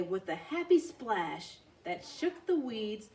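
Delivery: usual speaking voice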